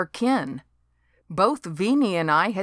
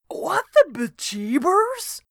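startled yelp; 10 semitones higher than usual. A narrator's reading. Devin struck on the head.

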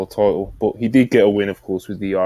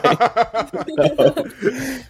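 high laugh. Real, not acted. Laughing at the joke.

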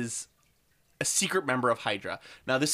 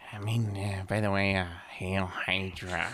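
low, slow drawn-out hail Hydra; lightly voiced sly senator; Senator Gary Shandling voice; 5 semitones lower, 3 dB quieter than usual. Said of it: "doing that voice".

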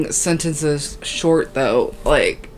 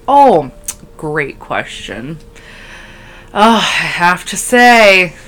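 interviewee; 6 dB louder, slower than usual. Pretend. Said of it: "pretend like I'm at a press conference".

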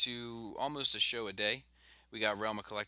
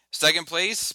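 higher, louder announcer call; contest announcer; 8 semitones higher, 9 dB louder, slower than usual. Pretend. Host reveals runner-up to listeners, announcer style. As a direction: ring it out like a contest result.

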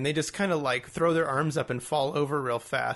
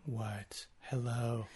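low, soft, neutral alien delivery; quiet, lower, imitating an emotionless alien; 4 semitones lower, 10 dB quieter, much slower than usual. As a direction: say it void of all emotion. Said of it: "they're void of all emotion".

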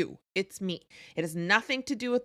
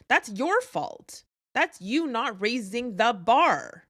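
slow emphatic; 2 semitones higher, 4 dB louder, slower than usual. Real, not acted. Blunt verdict to listener.

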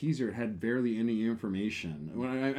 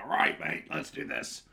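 quick Cockney bloke, half-whispered; Cockney accent; 6 semitones lower, slower than usual. Retold or imitated. Mimicking his Cockney bartender friend, a light Cockney accent. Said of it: "Oh, the Cockney accent?".

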